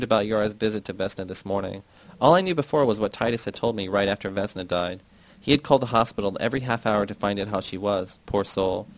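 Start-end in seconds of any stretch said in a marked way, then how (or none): none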